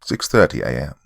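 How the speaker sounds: ordinary delivery, nothing marked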